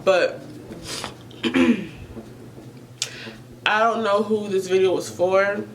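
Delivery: ordinary delivery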